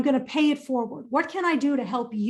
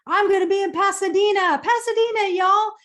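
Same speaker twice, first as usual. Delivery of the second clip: high, loud and thrilled; gushing, eager announcement; excited; 7 semitones higher, 6 dB louder than usual. Real, not acted. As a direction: burst out with real excitement about the trip. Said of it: "I'm super excited to be there".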